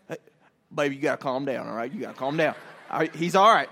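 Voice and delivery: low country dad soothing his wife; calm dad. Retold or imitated. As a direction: imitate a father staying cool under pressure. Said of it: "he's pretty calm under pressure".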